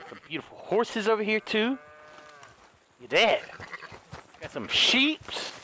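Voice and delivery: normal talking voice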